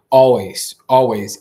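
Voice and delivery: Always loud and falling; stress on Always. Real, not acted. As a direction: hit Always hard each time. Emphatic repetition.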